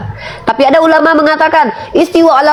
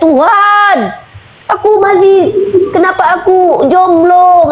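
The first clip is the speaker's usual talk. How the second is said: high, drawn-out wail to God; mock pleading cry; 8 semitones higher, slower than usual. Preacher mimicking a needy prayer shouted to God, mock pleading cry. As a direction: call out to God in mock despair. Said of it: "nggak perlu teriak-teriak".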